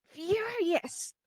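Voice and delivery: "Furious" drawn out, high on the first syllable, then falling; emphasized FURIOUS. Shown as a demonstration. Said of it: "I just emphasize what should furious should be".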